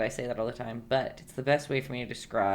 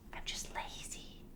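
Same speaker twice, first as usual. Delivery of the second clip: whispered aside; 20 dB quieter than usual. Real, not acted. Confessing aside to viewers.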